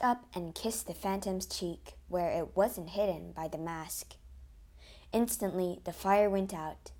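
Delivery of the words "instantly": usual speaking voice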